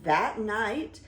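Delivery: ordinary delivery, nothing marked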